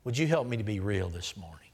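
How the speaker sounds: whispered-close sincere prayer; hushed prayer. Shown as a demonstration. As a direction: pray it under your breath. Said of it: "Would you say this to him".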